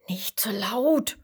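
sleepy horses whispering to hush. A narrator's reading. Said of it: "rufen die anderen Pferde".

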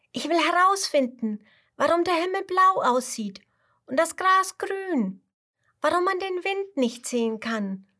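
put-on high child voice rattling off questions with wonder; a little cub bursting with curiosity; eager tiger cub. Tiger cub telling the chameleon everything it wants to know, high and eager.

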